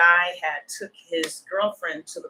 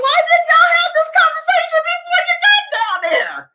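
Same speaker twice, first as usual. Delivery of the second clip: exasperated shout; 9 dB louder than usual. Retold or imitated. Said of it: "I was like".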